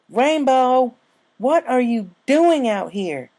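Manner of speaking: high, loud and slow call across the water. Acted. Applejack calling from the shore to Rainbow in the lake, loud and high. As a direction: shout to her friend thirty feet away.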